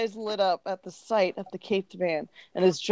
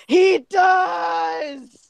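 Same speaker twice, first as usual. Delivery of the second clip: high, loud, drawn-out exclamation; gleeful high shout; awestruck cry; 8 semitones higher, 5 dB louder than usual. Acted. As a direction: cry it out in hammed-up amazement. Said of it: "his jaw dropped and he cried".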